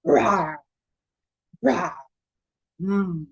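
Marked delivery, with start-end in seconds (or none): 0.1-1.9 lion roar imitation. Shown as a demonstration. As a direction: roar like a friendly lion for a child. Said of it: "It goes like this".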